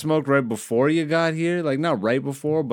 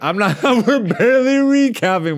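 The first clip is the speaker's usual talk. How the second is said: laughing; 9 semitones higher, 5 dB louder than usual. Real, not acted. Laughs repeating cohost's line.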